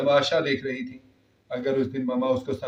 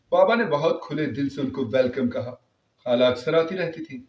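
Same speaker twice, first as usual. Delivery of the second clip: ordinary delivery, nothing marked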